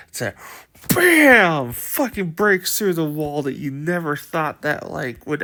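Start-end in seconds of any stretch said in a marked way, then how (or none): 0.9-1.7 sudden loud crash sound; loud bam sound. Retold or imitated. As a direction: slam it like a wall bursting. Wall breaking sound effect.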